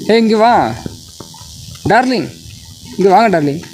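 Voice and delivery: high sing-song darling call. Real, not acted. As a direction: sweet-talk the dog over. Sweet-talking the dog, darling.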